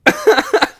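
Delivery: high, loud burst of laughter; bright real laughing. Real, not acted. Laughing at the memory.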